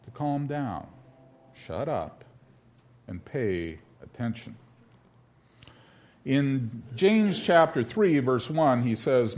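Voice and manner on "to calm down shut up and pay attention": quiet solemn. Shown as a demonstration. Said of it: "It's me telling you that God is telling us".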